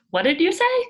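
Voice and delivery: loud, high, mocking call; mock shout. Jason cupping his ear to mock the substitute, loud and high. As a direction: holler it back like a smart aleck.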